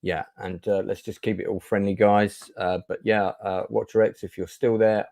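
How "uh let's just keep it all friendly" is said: usual speaking voice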